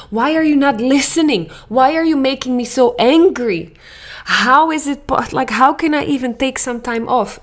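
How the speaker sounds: an overwhelmed mother's angry venting; frustrated rant. Retold or imitated. Acted stressed inner complaining.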